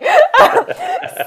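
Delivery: high, loud burst of laughter; laughing. Real, not acted. Host laughing off being corrected on guest's name, laughing.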